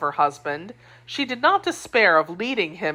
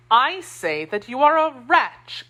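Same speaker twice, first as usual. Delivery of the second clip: slow, higher, cutting insult; the wife condemns her husband, slow and higher; angry; 7 semitones higher than usual. A narrator's reading. Angry wife, slow and higher. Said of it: "seen his wife angry".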